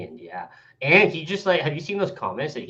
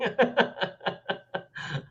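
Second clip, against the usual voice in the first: breathy laugh; 8 semitones higher, 6 dB quieter than usual. Real, not acted. Laughing at own mock quote.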